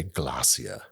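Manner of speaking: low British nature-narrator word; British narrator accent. Retold or imitated. Showing off a favorite narrator word, low British accent. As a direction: relish the British pronunciation, pitched low. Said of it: "never once got to say".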